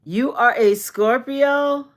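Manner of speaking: thrilled question to a viewer who just said they're a Scorpio; excited surprise. Real, not acted. Delighted disbelief.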